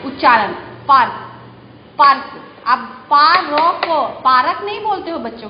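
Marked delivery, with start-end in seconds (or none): 2.7-4.1 पा, रॉ, कॉ split into separate beats; syllable by syllable. Shown as a demonstration. Teacher to children, splitting पा रॉ कॉ apart. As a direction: break पार्क apart as पा, रॉ, कॉ. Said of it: "अलग-अलग तोड़ के बोलेंगे".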